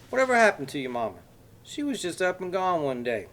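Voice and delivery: put-on high, loud Texas accent, folksy with a menacing edge; exaggerated Texas accent. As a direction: play the friendly Texan with a threat underneath. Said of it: "said in an exaggerated Texas accent".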